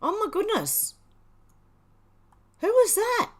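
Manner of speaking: high, loud stunned exclamation; surprised gasp. Real, not acted. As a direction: blurt it out, genuinely amazed. Sudden big donation on stream.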